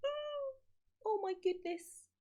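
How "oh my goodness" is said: ordinary delivery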